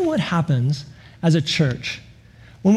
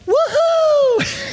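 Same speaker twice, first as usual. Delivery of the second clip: put-on kid's joyful whoop. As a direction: whoop like a thrilled little kid.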